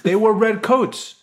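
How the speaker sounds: laughing. Real, not acted. Explaining redcoats amid laughter.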